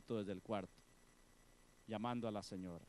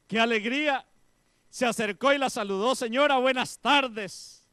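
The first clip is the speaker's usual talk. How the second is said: loud, high burst of joy; joyful exclaim; 9 semitones higher, 14 dB louder than usual. Acted. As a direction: shout out the happiness. Joyful discovery in sermon.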